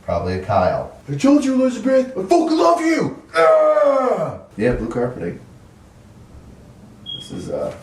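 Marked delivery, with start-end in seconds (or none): 1.1-3.2 high-pitched, overwrought cry of love; hammed-up desperate declaration; mock anguished yell. Acted lovers' quarrel of imagined tenant. 3.3-4.4 high, drawn-out melodramatic wail; hammed-up cry of despair; mock anguished scream. Acted outburst of imagined tenant.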